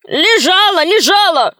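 high-pitched frantic cry; panicky, high insistence; shouted. A narrator's reading. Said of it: "крикнул людоед".

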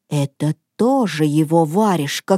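ordinary delivery

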